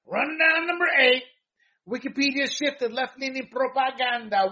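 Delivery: high, loud headline read; booming segment intro, easing as the story begins; segment announcer. Real, not acted. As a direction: call out the rundown number big, then drive into the story.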